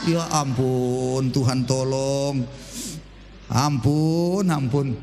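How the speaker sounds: pelan dan rendah, pura-pura putus asa; mock despair. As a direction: mengeluh panjang dan lambat. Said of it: "reaksi pengkhotbah". (keluhan lambat dan rendah)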